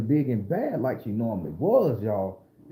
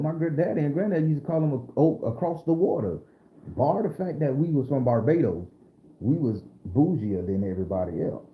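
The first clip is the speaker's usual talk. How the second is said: ordinary talk, nothing marked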